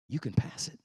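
breathless whisper of encouragement; hushed whisper to a struggling son; whispered reassurance. Retold or imitated. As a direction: whisper it close, like a secret. Repeated as a whisper.

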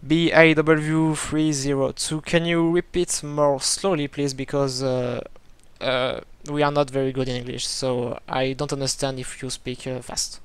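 slow, halting English with a French accent. Real, not acted. Pilot asking the tower to repeat slowly, French-accented English. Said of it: "mon accent, euh, anglais pittoresque".

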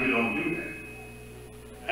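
put-on slow, hushed, breathy warning to a child. Grandmother hushing a misbehaving child, slow and hushed. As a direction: let it drop to a stern hush.

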